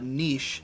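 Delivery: niche said as "neesh", rhyming with leash; soft "neesh" for niche; long-vowel niche. Shown as a demonstration. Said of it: "how I say n".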